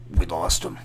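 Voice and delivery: a kid playing doctor, solemnly calling the death; grave TV doctor. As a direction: drop the voice like a TV surgeon. Friend declaring the patient dead.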